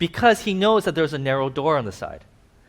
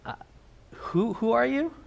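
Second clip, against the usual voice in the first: puzzled owner; 7 semitones higher, 4 dB quieter than usual. Retold or imitated. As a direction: ask like you truly don't know him.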